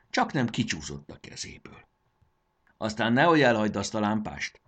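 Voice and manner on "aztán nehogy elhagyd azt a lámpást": plain reading voice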